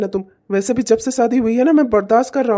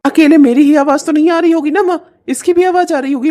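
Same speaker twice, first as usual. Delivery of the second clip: louder, higher protest; angry; 4 semitones higher, 6 dB louder than usual. Acted. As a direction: snap back defensively. Son argues back with mother. (माँ से गुस्से में बहस)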